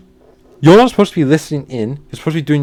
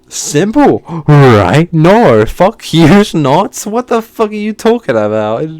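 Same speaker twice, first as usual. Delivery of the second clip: loud, high mock-simple then fury; sarcastic outburst; 4 semitones higher, 7 dB louder, slower than usual. Real, not acted. Ranting about the teacher's instructions, loud and sarcastic. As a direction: scoff then explode.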